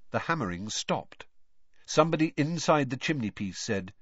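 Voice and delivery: plain reading voice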